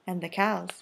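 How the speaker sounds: plain reading voice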